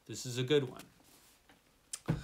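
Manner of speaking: "good" stretched long and slow; slow, lingering appreciation; slow, drawn out. Shown as a demonstration. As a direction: savour each word of This is a good one.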